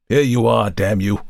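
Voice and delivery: put-on old woman handing over the letters in spite; snarled. A narrator's reading. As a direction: bite off the curse. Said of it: "she snarled".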